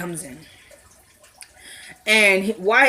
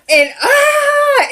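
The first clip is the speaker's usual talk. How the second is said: high laughing cry; real laughter, much higher; laughing shout; 4 dB louder than usual. Real, not acted. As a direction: burst out laughing. Laughing at the fight scene.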